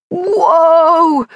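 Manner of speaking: loud, high call to halt the horse; calling whoa. A narrator's reading. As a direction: yank the reins and shout. Reining in a galloping horse.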